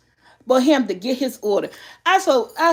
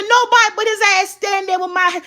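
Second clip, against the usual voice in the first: mad retelling; 8 semitones higher than usual. Retold or imitated. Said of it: "who was mad?".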